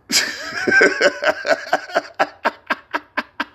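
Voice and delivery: very high shrieking cackle; squealing laugh. Real, not acted. Big laugh.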